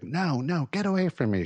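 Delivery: high-pitched, lightly acted fear; retold scared refusal. Woman refusing the psychiatrist's help, retold as scared. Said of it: "She was scared of him".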